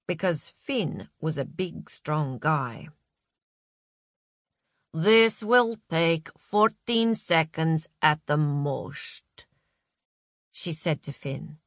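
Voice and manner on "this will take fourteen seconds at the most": slow, with "most" thickening into a slurred "mosht"; thickened last word. A narrator's reading. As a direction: let the tongue thicken on "most". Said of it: "Her voice thickened on the word most".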